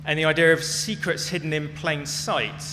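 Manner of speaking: ordinary talk, nothing marked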